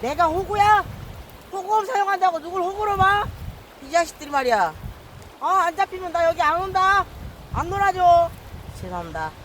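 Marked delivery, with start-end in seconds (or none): 0.0-8.3 indignant rant. Real, not acted. Angler scolding fish for mocking his lure, indignant rant. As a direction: scold the fish like they insulted you.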